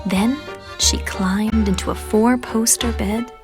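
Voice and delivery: usual speaking voice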